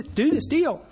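normal talking voice

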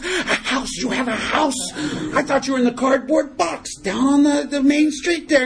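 high-pitched, incredulous outburst; mock astonishment. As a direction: act amazed at what the drunk owns, hammed up. God acts astonished at house.

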